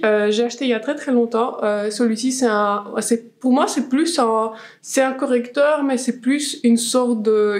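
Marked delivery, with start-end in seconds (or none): none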